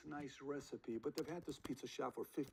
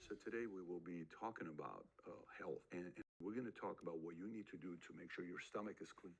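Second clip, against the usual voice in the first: low, soft, measured delivery; quiet, unhurried explainer voice; low-key podcaster; 8 semitones lower, 6 dB quieter than usual. Acted. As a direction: imitate a subdued health podcaster, low and even. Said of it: "Some talk like this".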